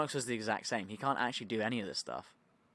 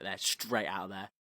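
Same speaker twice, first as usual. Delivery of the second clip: ordinary delivery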